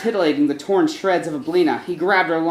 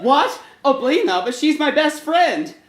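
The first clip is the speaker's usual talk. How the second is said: high, shocked cry of disbelief; shocked protest; 8 semitones higher than usual. Acted. Monster shocked at his friend's death, high cry of disbelief. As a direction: blurt it out, aghast.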